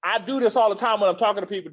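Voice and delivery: ordinary talk, nothing marked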